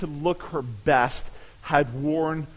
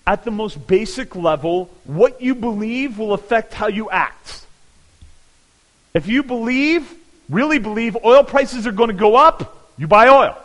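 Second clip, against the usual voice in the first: louder emphatic preaching push; forceful preaching; 5 semitones higher, 9 dB louder than usual. Acted. Pastor pressing belief on congregation, louder emphatic preaching. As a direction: drive the point home to the church.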